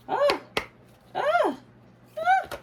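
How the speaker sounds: very high, loud squealing ahs; excited squeals. Real, not acted. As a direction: squeal with delight. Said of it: "You know what I'm saying?".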